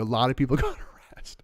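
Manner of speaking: hushed mocking whisper with a laugh; unvoiced, conspiratorial mock whisper. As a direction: whisper it as a sly jab.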